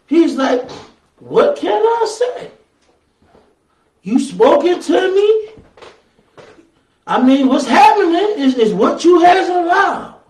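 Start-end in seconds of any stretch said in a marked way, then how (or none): 1.2-10.2 high-pitched, imploring questions, voice rising; pleading writer. Retold or imitated. Preacher acts out the writer answering God, high and imploring.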